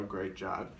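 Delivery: normal talking voice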